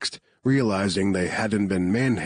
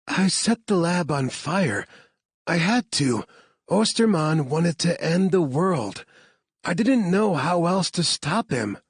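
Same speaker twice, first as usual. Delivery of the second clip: a higher heroine voice, urgent and earnest; shaken heroine; 10 semitones higher than usual. Acted. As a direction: justify it to them, you had to. Claire explains, shaken heroine.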